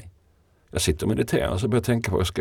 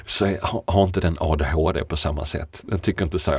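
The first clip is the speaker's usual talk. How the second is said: ordinary delivery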